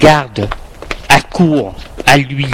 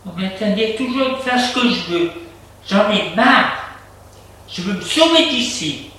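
higher, frustrated muttered thought; fed-up self-talk; 6 semitones higher than usual. A narrator's reading. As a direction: complain to yourself, pitched up. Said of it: "Adrien soupire et se dit". (ras-le-bol, pour soi-même)

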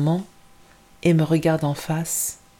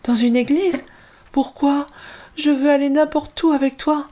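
put-on upset pleading child; 10 semitones higher than usual. Girl begging her mother not to leave her, high and pleading. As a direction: beg not to be left behind.

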